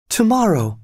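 sharp high cry of alarm. A narrator's reading. Solange startled that her father leaves so soon, crying out. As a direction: leap up on the word in shock. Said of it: "cried Solange".

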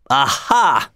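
storybook cry of triumph; narrator's mild gloating exclamation. A narrator's reading. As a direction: exclaim it without shouting. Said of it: "he cried".

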